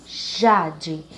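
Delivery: "Jade" drawn out on "Ja", falling away after; stress on first syllable. Shown as a demonstration. Teacher showing the strong syllable, stressed "Ja" in "Jade". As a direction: stretch and punch the "Ja" in "Jade". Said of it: "a sílaba já".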